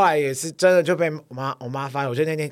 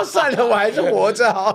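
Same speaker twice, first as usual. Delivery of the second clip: higher, louder, laughing through the quote; laughing mock mother; 7 semitones higher, 6 dB louder than usual. Son voicing his mother's thoughts, laughing. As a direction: play mom giving up, giggling. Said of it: "他想说".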